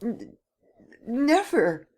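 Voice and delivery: high, stammering refusal; Gérard stutters his refusal in fear; stuttering. Acted. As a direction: stumble over the no. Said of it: "he stuttered".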